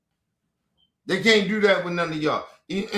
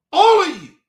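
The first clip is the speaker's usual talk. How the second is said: higher and louder, flung at them all; loud outburst; 9 semitones higher, 8 dB louder than usual. Real, not acted. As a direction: shout it at the whole family. Ranting at his family.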